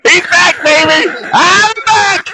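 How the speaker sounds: high, loud whoop of triumph; excited cheer. Acted. NPC cheering as the fallen soldier rises, loud whoop. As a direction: cheer him back to his feet.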